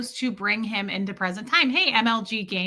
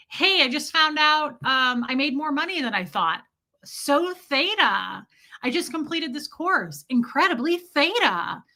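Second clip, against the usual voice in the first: mock-delighted, pitched up and gushing; uptone happy; 5 semitones higher than usual. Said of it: "super uptone, happy stuff".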